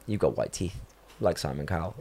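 low, quiet, emotionless retelling; imitated deadpan observation. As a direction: say it with zero reaction, like a blunt child. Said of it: "with no facial expression, just deadpan".

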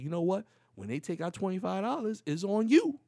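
high, building to loud on the last words; retold heated ultimatum; exasperated warning. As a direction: give in but put the blame on your partner. Warns wife at end of quarrel.

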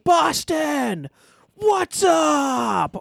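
big arena shout; shouted catchphrase. Retold or imitated. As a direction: yell it to the crowd. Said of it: "But he goes".

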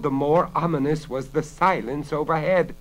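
ordinary delivery

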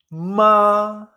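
"Ma" high, loud and held level; first tone. Shown as a demonstration. Teacher asks learner to repeat, "Ma" sustained high and level. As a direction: hold "Ma" steady on a high pitch. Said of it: "Repite conmigo".

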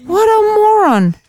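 high, long-held scornful exclamation; exasperated disbelief. Real, not acted. Woman scorns her date for the listener, exasperated. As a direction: rub in the scorn, stretching it up high.

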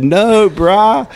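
high, loud laid-back surfer drawl; surfer dude voice. Retold or imitated. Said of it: "and Ben said".

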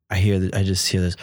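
ordinary talk

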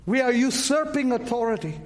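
ordinary talk, nothing marked

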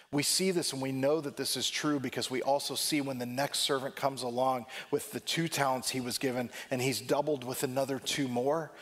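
ordinary talk, nothing marked

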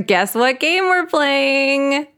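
slow, high, drawn-out tease; teasing reveal. Acted. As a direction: build up the big reveal. Teasing game-show reveal.

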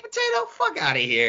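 squeaky high incredulous question; can't believe what he heard. Real, not acted. Incredulous reaction in the argument.

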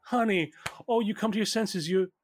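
high-pitched, effusive mother voice; gushing mother. Acted. Mother thinks daughter came to work with her, gushing mother. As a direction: gush over her in a high voice.

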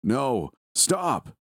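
a hammed-up cry of despair climbing high; despairing scream. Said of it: "A despairing male voice screamed".

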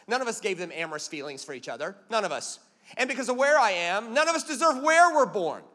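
normal talking voice

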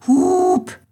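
very high, loud honk of a tractor horn; imitated horn honk. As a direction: blare out a horn blast. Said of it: "drückte die Hupe". (lautes, sehr hohes Hupen)

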